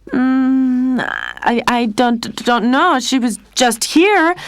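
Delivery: halting, nervous stammer; stuttering. A narrator's reading. Lionel caught covering for his sister, stuttering. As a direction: trip over the words, caught out. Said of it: "Lionel was stuttering".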